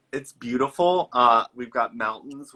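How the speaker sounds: normal talking voice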